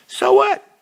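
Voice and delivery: high, loud "so what" flung out; a sudden indignant shout; defiant outburst. Acted. Character's defiant quote in story.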